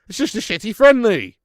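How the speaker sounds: loud, high scoff at the fixture; loud disgusted dismissal; loud exasperation. Real, not acted. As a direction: blurt it out in disgust. Exasperated outburst about the match.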